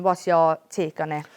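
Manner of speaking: ordinary talk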